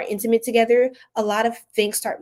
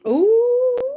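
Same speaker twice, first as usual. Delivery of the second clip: long, loud, rising ooh; intrigued high-pitched ooh; 6 dB louder than usual. Real, not acted. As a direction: let out a high swooping ooh. Reacts alone to the cards.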